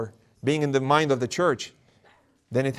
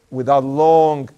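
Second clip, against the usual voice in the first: ordinary talk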